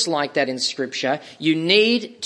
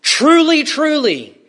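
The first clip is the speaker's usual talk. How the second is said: high ringing first Truly, the second dropping back; emphatic doubled opening; 9 semitones higher, 6 dB louder, slower than usual. Acted. Preacher quoting Jesus, ringing emphatic Truly, truly. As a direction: ring out Truly, Truly like a solemn call. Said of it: "He would begin by saying".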